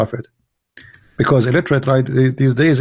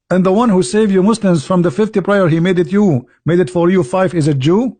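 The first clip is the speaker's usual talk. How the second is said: ordinary delivery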